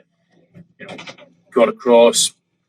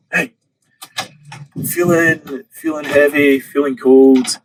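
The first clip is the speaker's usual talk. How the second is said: calling up from the water, higher and louder; loud, raised-pitch mock call; retold shout; 6 semitones higher, 7 dB louder than usual. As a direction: re-enact a swimmer shouting up to the boat. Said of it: "I shout up".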